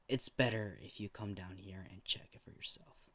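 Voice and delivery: whisper. Acted. Doctor hushed on phone.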